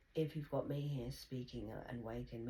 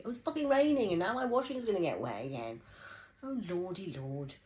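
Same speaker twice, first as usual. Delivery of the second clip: higher, louder outburst at the rain; real exasperation; 6 semitones higher, 8 dB louder than usual. Real, not acted. Upset that rain will wet her washing, exclaiming. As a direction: groan about the washing getting wet.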